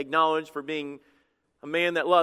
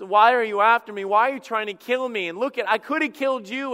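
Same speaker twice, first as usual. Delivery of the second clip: pleading; 5 semitones higher, 5 dB louder than usual. Retold or imitated. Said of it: "he was pleading".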